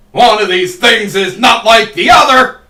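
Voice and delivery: slow, loud, mock-outraged shout; loud exclamation. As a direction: bellow it like a big reveal. Exclaims at odd item out.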